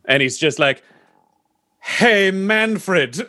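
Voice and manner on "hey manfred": high, drawn-out posh British greeting; very fancy British gentleman, pitched high; fancy British accent. Acted. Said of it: "he's looking really British".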